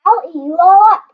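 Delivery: put-on fast, loud, high growl of a wild boy; wild boy threat. Max defying his mother, a fast loud threat. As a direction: snap it back like a little monster. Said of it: "and Max said".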